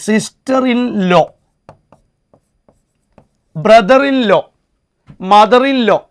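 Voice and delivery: "sister in law" drawn out slowly; teacher spells out "sister in law" slowly; slow example. Shown as a demonstration. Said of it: "അങ്ങനെയാണെങ്കിൽ".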